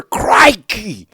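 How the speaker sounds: high-pitched Aussie exclamation; hammed-up Australian TV adventurer; crocodile hunter impression. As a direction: burst out in a bad Australian accent. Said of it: "the crocodile hunter".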